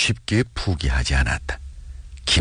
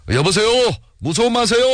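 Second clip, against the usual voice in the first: calling out; faster than usual. A narrator's reading. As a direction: call after someone far away, voice soaring high. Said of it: "다른 사람들도 따라서 소리를 지었다".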